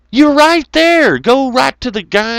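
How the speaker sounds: high loud, slow exasperation; exasperated outburst. Retold or imitated. Ranting about the toll booth guy, high and loud. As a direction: throw up your hands at the toll guy.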